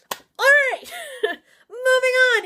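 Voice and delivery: giddy laughing shout. Real, not acted. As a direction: laugh it off and move on. Laughing off the gross story.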